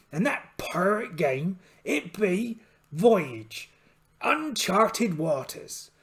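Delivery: put-on slow drawn-out pirate accent; pirate captain announcing slowly. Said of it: "I'm gonna try and keep this voice up".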